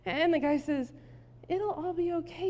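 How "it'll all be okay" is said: soft, higher-pitched soothing; chill. Acted. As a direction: play the unbothered guy, gently reassuring. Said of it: "he's, like, chill".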